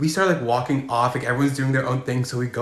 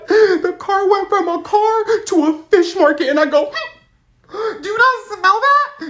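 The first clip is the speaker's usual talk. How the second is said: high, loud and slow disgusted disbelief; mock disgusted shock; 6 dB louder, slower than usual. In the car with his crush, high slow disgust. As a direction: drag out the horror of the smell.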